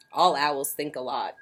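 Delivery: ordinary delivery, nothing marked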